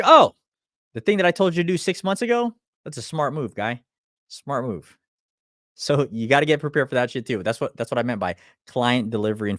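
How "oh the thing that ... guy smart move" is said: sarcastic praise. Retold or imitated. Mocking client who finally listened.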